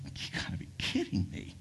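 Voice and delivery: amazed, whispered disbelief; stunned whisper. Acted. Tebow to his publicist, astonished, in a whisper. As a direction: breathe it out in astonishment.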